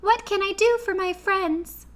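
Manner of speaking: Queen of the Mice to her friends, squeaky and kind; squeaky little voice. A narrator's reading. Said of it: "in her squeaky little voice".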